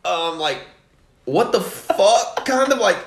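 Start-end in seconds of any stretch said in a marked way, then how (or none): 1.2-2.5 slow disbelieving what. Retold or imitated. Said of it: "and she's like".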